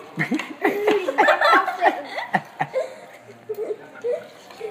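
big loud burst of laughter; loud giggle. Real, not acted. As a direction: crack up loudly. Kids crack up.

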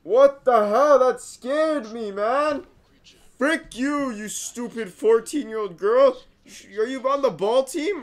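high, loud, indignant after a scare; startled and protesting, pitch up; rattled outburst. Real, not acted. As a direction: scold the thing that made you jump.

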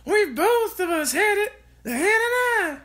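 put-on high, loud old woman's cry; Grandma shouting. A narrator's reading. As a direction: crow it out as the grandmother. Grandma continues.

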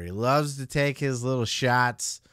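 ordinary delivery

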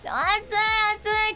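high, very slow, drawn-out moan of "暑い"; mock whining about the heat. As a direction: whine it out, wilting in the heat. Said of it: "って言ってたら". (暑さにへばったように高く間延びした声で)

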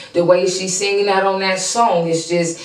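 ordinary talk

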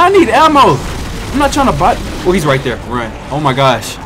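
ordinary delivery

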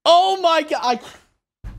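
high, loud cry of frustration; exasperated outburst. Real, not acted. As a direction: burst out high at a near miss. Gamer just misses the landing.

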